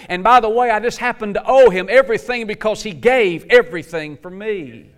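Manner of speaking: loud, high, driving sermon climax; rising fervor of a preacher in full cry; full-force preaching. Acted. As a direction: preach it with everything you've got.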